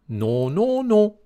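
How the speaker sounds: high squeaky no, rising to the last non; little pig refusing. A narrator's reading. The third pig refuses the wolf, high squeaky no.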